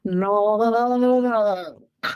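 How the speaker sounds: one long wavering "No, no, no, no, no" from far back in the throat; guttural throat sound. Shown as a demonstration. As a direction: run "No, no, no, no, no" together, throaty, as with a cleft palate. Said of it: "speaking from the way back in their throat".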